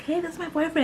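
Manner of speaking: high, breezy mock introduction; mock casual intro. Mocking a flippant girlfriend introducing a boyfriend to mom, high and breezy. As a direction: toss it off like a careless girlfriend.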